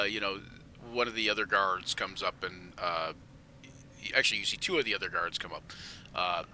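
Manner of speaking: ordinary talk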